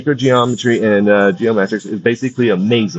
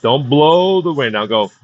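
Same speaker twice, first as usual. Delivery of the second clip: wrong breath; 4 semitones higher than usual. Shown as a demonstration. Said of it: "that's the wrong breath".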